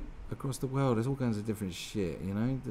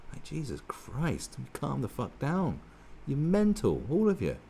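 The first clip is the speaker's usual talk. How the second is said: normal talking voice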